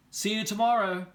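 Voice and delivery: high and loud teasing goodnight; taunting sing-song. Acted. Wendell taunting Sophie with his flashlight, high and loud. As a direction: tease her with a bright threat.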